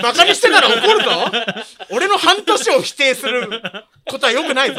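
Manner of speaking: loud, laughing fake threat; mock anger. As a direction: playfully scold through laughter. Teased, fakes being offended. (笑いながらのふざけた怒り)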